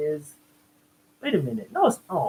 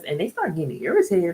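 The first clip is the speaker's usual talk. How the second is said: ordinary talk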